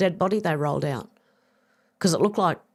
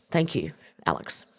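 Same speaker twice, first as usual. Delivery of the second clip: ordinary talk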